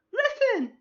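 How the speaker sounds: piercing, very high loud fairy call; Navi. Acted. Fairy from Zelda calls for attention, shrill imitation. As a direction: squeak it out sharply in loud falsetto. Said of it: "the Navi voice from".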